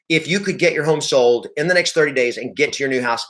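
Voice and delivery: ordinary talk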